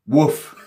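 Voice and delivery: a quick playful dog woof; mock woof. Shown as a demonstration. Teacher showing students what bark means, playful woof. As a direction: bark like a friendly dog for the class. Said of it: "To bark".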